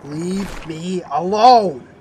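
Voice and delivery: whiny drawn-out plea. Acted. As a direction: stretch it out like a sulky child. Sulking refusal to his boss.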